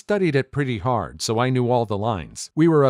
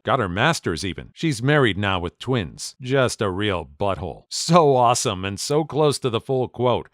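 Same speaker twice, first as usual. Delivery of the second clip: plain reading voice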